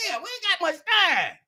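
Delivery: usual speaking voice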